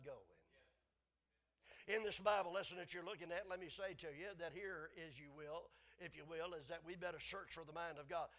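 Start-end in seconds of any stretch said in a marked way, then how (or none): none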